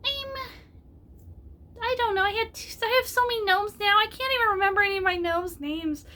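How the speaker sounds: tiny excited gnome rambling. Shown as a demonstration. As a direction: chatter like a flustered little gnome. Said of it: "this is my gnome voice".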